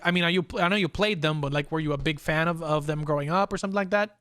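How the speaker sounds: ordinary delivery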